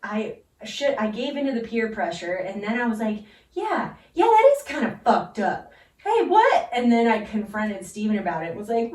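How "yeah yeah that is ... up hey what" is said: mock indignation. Acting her own indignant self.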